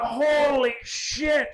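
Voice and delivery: slow, high stunned exclamation; shocked. Retold or imitated. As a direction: gasp it out at the huge wave. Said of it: "And I went".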